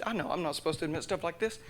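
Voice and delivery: normal talking voice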